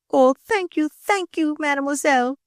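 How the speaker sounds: high-pitched, gushing French thanks; eager gratitude. A narrator's reading. Madame promised back to her husband, eagerly grateful. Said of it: "She held out her hand eagerly".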